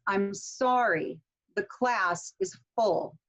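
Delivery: slow, with "sorry", "class" and "full" stressed; content-word stress. Shown as a demonstration. Teacher showing content words, stress on "sorry", "class", "full". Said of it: "notice that we have this stress on these words".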